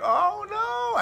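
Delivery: high, wailing "oh no"; retold dismay. Hearing the church is waiting, dismayed. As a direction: leap up high in mock alarm.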